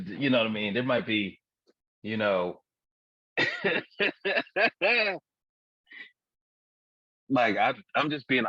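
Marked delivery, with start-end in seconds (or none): 3.4-5.2 high run of quick giggles; real, tickled laughter; giggling fit. Real, not acted. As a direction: laugh high in rapid pulses. Cracking up at the joke.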